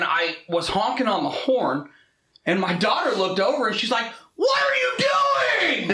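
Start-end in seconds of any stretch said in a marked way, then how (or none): 4.3-5.9 shrill high yelled question at the other driver; a kid's high startled shout. Retold or imitated. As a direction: yell it high and loud, half out of the car. Daughter yells at the lady.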